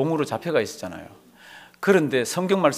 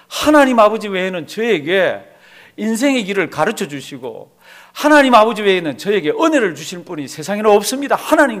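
loud, raised, urgent prayer; fervent pleading prayer; 4 semitones higher, 7 dB louder than usual. Acted. Believer clinging to God alone, fervent pleading prayer. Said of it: "그러고 붙드는 그 자를".

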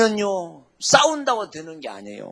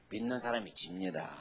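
ordinary talk

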